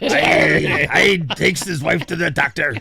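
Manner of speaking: hammed-up pirate storyteller. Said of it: "Sound like a pirate".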